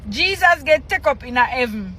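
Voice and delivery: ordinary delivery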